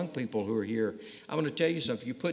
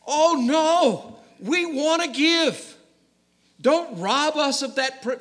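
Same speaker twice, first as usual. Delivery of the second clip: much higher, louder, pleading cry; begging congregation; 13 semitones higher, 9 dB louder, slower than usual. Acted. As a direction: beg to be allowed to give, high and loud. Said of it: "They begged".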